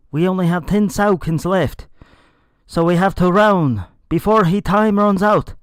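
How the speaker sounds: high, loud urgent yell. Acted. Joan warning the others before the explosion, urgent loud yell. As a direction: shout the warning, pressing everyone to run. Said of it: "Joan yelled".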